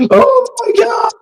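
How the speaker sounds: high-pitched imitation of a panicking shriek; hysterical. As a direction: wail it like a hysterical bystander. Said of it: "this crazy hysterical bitch".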